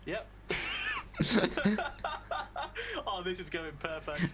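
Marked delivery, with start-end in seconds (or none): 0.5-3.0 a high, loud burst of laughter; sudden loud laugh at the wizard's name. Real, not acted.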